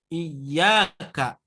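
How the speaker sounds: normal talking voice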